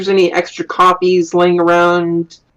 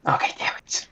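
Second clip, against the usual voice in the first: whisper; 12 dB quieter than usual. Real, not acted. Muttered frustration.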